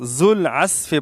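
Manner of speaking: ذو العصف read as "zul-asfi", with z and light s; mistaken letters. Retold or imitated. Teacher imitating most people's misreading, wrong letters. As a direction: flatten ذو العصف to z, no ain, thin s. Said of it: "ذال کو زا پڑھتے ہیں".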